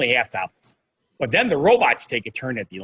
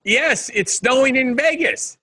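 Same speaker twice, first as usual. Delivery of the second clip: higher, slower delivery; 10 semitones higher, slower than usual. Shown as a demonstration. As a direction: stretch it out up high. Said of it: "I wanted to match you".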